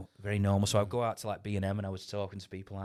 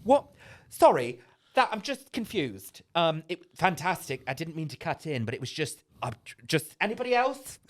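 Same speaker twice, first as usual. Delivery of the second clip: lightly voiced flustered director, higher, rising to a shout; confused director; 6 semitones higher, 3 dB louder than usual. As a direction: stammer like a director thrown off. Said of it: "He goes".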